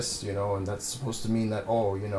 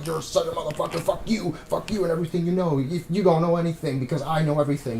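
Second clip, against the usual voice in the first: higher, louder mock tough-guy rant; mocking loose-cannon rant; 6 semitones higher, 3 dB louder than usual. Reviewer mocking Wahlberg's character: higher, louder hammed-up rant. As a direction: spit it at him like a cocky hothead. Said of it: "he's like".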